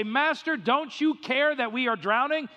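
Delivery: high, urgent plea in the storm; panicked disciples. Acted. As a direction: beg him to wake up. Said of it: "they wake him up and they say".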